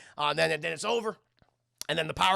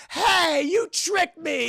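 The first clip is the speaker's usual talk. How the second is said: high, loud accusation; mock indignant shout; 11 semitones higher, 7 dB louder, much slower than usual. John finding out the demon's trick, mock indignant shout. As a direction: yell it like you've been cheated.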